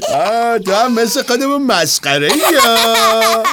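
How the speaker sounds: laughing. Acted. Bear laughing at the cub.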